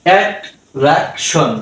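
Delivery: Attraction slowly, one syllable at a time; slow three-beat demo of attraction; split into syllables. Shown as a demonstration. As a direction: break attraction into at, রাক, শন. Teacher splits word into syllables.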